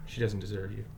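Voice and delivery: put-on a quiet, even firmness facing an angry boy; calm and steady. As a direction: say it stoically, refusing to rise to it. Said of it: "stoic, calm, consistent".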